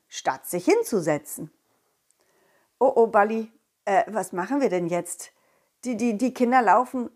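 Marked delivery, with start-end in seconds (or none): none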